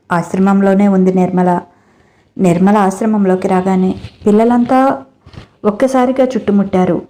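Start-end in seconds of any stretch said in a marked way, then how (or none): none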